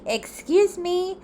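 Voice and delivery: high, slow, put-upon excuse me; indignant. Retold or imitated. Reading the Medicaid email, slow indignant high excuse me. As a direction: act offended by the letter.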